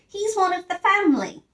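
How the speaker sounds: high-voiced girl insisting he's family. Judy voiced in story.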